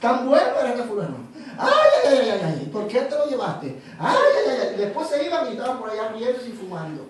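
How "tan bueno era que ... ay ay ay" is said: laughing mock wail of hired mourners; hired mourners' wail. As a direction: ham up a paid mourner's cry. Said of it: "Y llegaban así".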